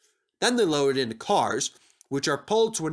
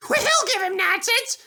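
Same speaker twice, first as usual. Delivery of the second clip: much higher sly freight car voice, mock giggle; giggling freight cars; 5 dB louder than usual. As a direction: sneer it out with a snigger. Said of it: "giggled the freight cars".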